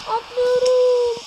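slow drawn-out cry as he leaps; yelled jump. Real, not acted. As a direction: player yelling as he risks the jump. Daring jump after prayer.

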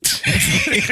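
high-pitched burst of laughter. Real, not acted. Man scoffs at trusting your woman, high laugh.

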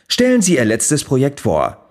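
normal talking voice

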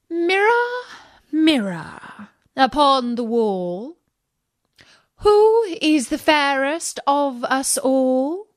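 high, slow, commanding queen; queen's incantation. A narrator's reading. Queen addressing her magic mirror, high and commanding. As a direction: call on the mirror grandly.

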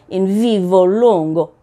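mock Italian accent, hammed-up on Vivo Longo; bad Italian accent. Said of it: "Worst Italian accent ever".

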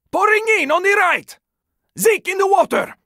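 high urgent shouting; warning the squad of the horde, alarmed shout; alarmed callout. Acted. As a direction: call out the swarm in alarm. Zombie swarm attacking.

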